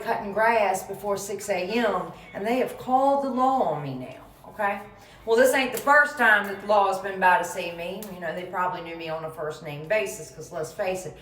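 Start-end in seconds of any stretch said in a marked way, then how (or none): none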